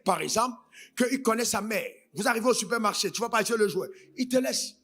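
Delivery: ordinary talk, nothing marked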